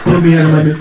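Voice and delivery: low-pitched, mock-desperate old man; low comic plea of a henpecked husband; pleading old husband. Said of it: "he's pleading with me".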